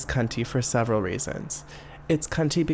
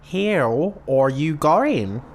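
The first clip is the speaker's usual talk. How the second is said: louder, very drawn-out mock Aussie; hammed-up Australian greeting, slow and loud; exaggerated Australian accent; 2 semitones higher, 6 dB louder, much slower than usual. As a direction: stretch each word into broad imitated Australian. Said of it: "the way that Australian people sound".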